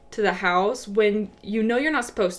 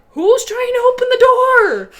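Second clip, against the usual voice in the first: alarmed yell; 10 dB louder than usual. Retold or imitated. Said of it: "I'm like".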